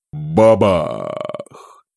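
booming blast word; explosion imitation. Bomb explosion sound. (громкое «бабах»)

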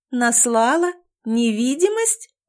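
incredulous echo, each word rising sharply; astonished. Acted. Girl hears she supposedly cast invisibility, astonished. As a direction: stretch each word into a disbelieving question. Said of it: "У неё глаза полезли на лоб".

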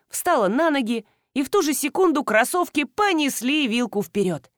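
usual speaking voice

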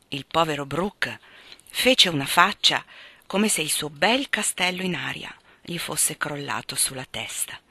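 ordinary delivery

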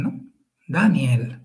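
usual speaking voice

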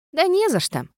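chirpy carefree ghost. A narrator's reading. Ghost character voice.

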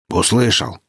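plain reading voice

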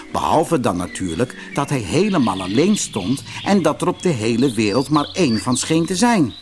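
usual speaking voice

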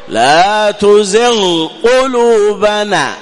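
تزغ slow with the غ fully sounded before قلوبنا; clear ghain. Shown as a demonstration. Teacher models the clear ghain for students. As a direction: hold the غ apart from the ق. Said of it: "وإظهار الغين عند القاف".

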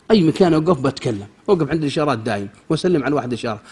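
ordinary talk

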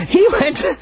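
ordinary delivery

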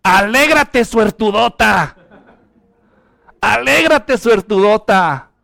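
slow, drawn-out cheerful Mexican greeting; Mexican-style Gabriel. Pretend. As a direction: stretch it out like a Mexican angel. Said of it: "si Gabriel hubiera sido mexicano".

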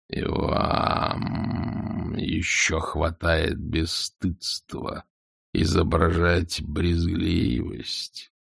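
very slow, low, hushed dragon; slow scornful dragon. A narrator's reading. Dragon thinks at Vonce.

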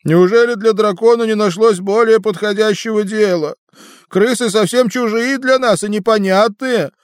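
высокий голос юного дракона; звонкий, обиженный дракон. A narrator's reading. Дракон Болтун говорит. (голос дракона)

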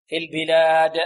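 في البلاد with a strongly bounced final د; strong qalqala. Shown as a demonstration. Teacher stresses strengthening the echo, strong bounced د in البلاد. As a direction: release the د of البلاد hard, with an echo. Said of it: "ولا بد من تقوية القلقلة".

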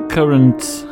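ordinary talk

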